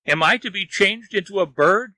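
high, distressed lament; anguished groan. A narrator's reading. Wang fears turning into a bird, groaning. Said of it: "he groaned".

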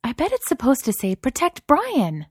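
very high, delighted voice; high-pitched delight. A narrator's reading. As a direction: pipe up in rising delight. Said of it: "her voice rising in high-pitched delight".

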